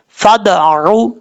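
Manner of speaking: slow फदअू with a bouncing echo on द; with qalqala. Shown as a demonstration. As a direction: let the द bounce back. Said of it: "उसको कलकला कहा जाता है". (कलकला के साथ फदअू)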